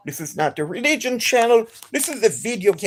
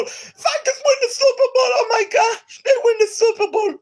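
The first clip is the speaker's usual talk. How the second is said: far higher, shouting, laughing and half crying; ecstatic Super Bowl reaction; 13 semitones higher than usual. Retold or imitated. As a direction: relive the Vikings win like you lost your voice. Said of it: "I nearly lost the voice when the Vikings won".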